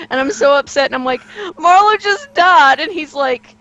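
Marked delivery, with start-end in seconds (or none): 1.6-2.8 higher, louder, slow and tearful; retold tearful cry. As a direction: relive the moment you broke down in tears. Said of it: "I'm in tears".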